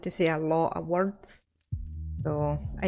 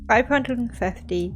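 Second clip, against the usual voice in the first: normal talking voice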